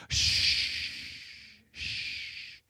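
gentle hush; whispered shh, calming someone; soft shushing. Acted. Jesus quieting boastful Peter, soft shushing.

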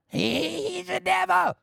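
very high, squealed caricature; mock hysterical accuser. Preacher playing people inventing stories in hate, high mock hysteria. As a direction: squeal the accusation like a frenzied mob member.

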